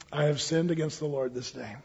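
soft, low admission of guilt; acted humbled king, hushed and low; subdued confession. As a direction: confess quietly and simply. Acts David's subdued confession.

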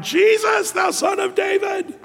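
a beggar crying out for help; desperate cry out. Retold or imitated. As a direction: call out to Jesus like a blind beggar at his end. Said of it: "he's crying out".

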